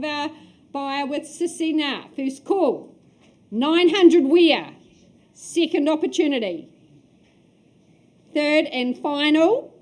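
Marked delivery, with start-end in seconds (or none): 2.2-9.7 louder, slow call into the room; calling for bids. Real, not acted. Auctioneer asks room for nine hundred, slow loud call. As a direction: draw out the ask for a bid.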